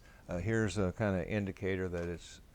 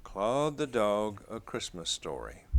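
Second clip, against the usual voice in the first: put-on reading to children; 7 dB louder than usual. A narrator's reading. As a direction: read the title page aloud to little ones. Reading picture book aloud.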